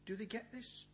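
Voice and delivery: ordinary delivery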